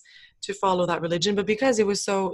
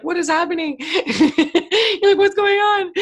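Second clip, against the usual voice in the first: high, laughing, bewildered; laughing bewilderment; 9 semitones higher, 7 dB louder, slower than usual. Retold or imitated. After crying in class, laughing in confused wonder. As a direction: laugh through the confusion, pitched up.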